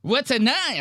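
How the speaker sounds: very high, frantic mock voice with a laugh; panicked Mark. Teasing Mark's terror at a nicotine pouch, squealing high. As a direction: squeal it like he thinks nine means a gun. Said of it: "quoting Mark again".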